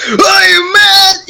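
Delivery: high, loud hammed-up shout; over-exaggerated mock anger. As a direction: overdo the fake anger, screaming. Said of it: "you scream and you shout".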